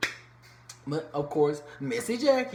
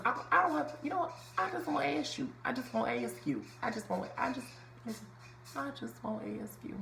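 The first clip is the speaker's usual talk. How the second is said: high, soft New Orleans twang; Angel; 8 semitones higher, 6 dB quieter, faster than usual. Retold or imitated. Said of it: "Like the little New Orleans twang".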